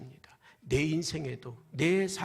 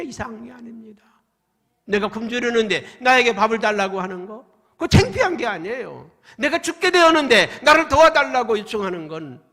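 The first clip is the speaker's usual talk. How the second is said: full-force preaching; 7 semitones higher, 13 dB louder than usual. Acted.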